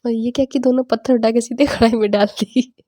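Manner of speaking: giggling through the words, real laughter; can't stop laughing remembering mom's face; laughing at the memory of mom's expression while telling viewers; laughing while talking. Real, not acted. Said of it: "मुझे अभी हंसी आ रही".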